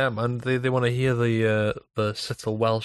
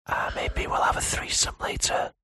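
breathy ASMR whisper; 4 semitones higher than usual. Pretend. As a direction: whisper close to the mic. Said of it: "Whisper me your dreams".

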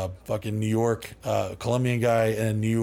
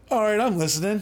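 intrigued; 11 semitones higher, 4 dB louder than usual. Retold or imitated. Intrigued by cops' offer.

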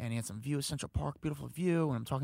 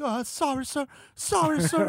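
much higher, cowering mock-meek sorry; timid apology; 12 semitones higher, 5 dB louder, slower than usual. The crew guy faking fear for Trump, high and grovelling. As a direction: act shaken so he thinks he won. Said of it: "my hands are shaking".

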